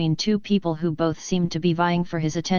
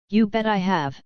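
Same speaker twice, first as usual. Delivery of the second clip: cocky swagger. A narrator's reading. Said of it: "his voice puffing up with the kind of cocky swagger".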